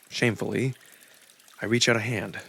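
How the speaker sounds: ordinary talk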